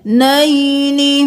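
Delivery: "नईनी" with a clear short ee on the noon; kasra on noon. Shown as a demonstration. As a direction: say "नईनी" ending crisply in ni. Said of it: "नून ज़ेर नी".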